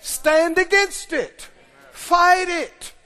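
slow, loud emphatic declaration; preaching hard. Acted. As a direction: hammer it home from the pulpit. Preaching at full force.

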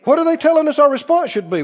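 normal talking voice

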